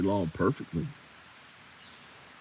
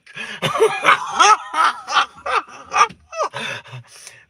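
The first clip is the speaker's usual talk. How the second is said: shrieking laugh; 10 dB louder than usual. Real, not acted. After mock scene.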